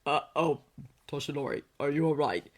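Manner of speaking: uneasy low-voiced check-in; distressed concern. A narrator's reading. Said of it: "the genuine distress in Shota's".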